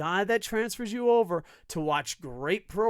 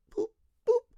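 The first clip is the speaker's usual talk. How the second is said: mock beeps. Imitated sound.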